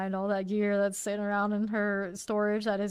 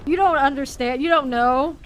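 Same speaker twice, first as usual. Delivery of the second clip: louder, higher mock lecturing voice; mimicking his explanation; 4 semitones higher, 9 dB louder than usual. Mocking the partner who explains badly, louder and higher. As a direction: imitate him talking down, a bit raised. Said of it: "this is how he explains things".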